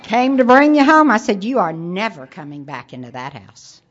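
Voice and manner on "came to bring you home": put-on loud, high, slurry drunk husband. Drunk husband stumbling into the ER, mocked loudly. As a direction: play the staggering drunk with a laugh. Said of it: "my Prince Charming staggers".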